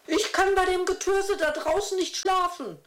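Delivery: hoch und trotzig beschwert; aufmuckend. A narrator's reading. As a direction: quengelig widersprechen. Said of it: "muckte eine der Frauen auf". (hohe, patzige Frauenstimme)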